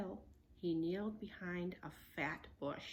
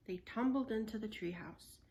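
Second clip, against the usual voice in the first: ordinary delivery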